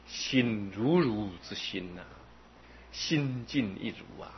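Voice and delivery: ordinary talk, nothing marked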